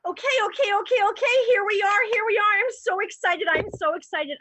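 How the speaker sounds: a new baker squealing on her first day; excited. Acted. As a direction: burst in giddy with excitement. Improv character arrives at bakery.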